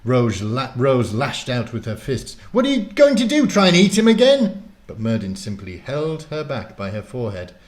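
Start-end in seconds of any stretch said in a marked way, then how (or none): 2.5-4.6 high, loud outraged challenge; furious, high-pitched protest; angry shout. A narrator's reading. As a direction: yell it accusingly at full pitch. Rose lashes out at the wizard.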